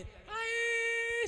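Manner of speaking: high stretched-out mock wail. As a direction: draw out a fake cry of grief. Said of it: "ऐसे करके जाती". (नकली रोने की लंबी आह)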